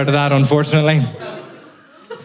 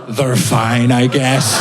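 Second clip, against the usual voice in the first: lightly imitated, lower voice; Liam Neeson; 6 semitones lower, much slower than usual. Actor giving up after two weeks, low mock resignation. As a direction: give up in a low voice. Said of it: "he was like".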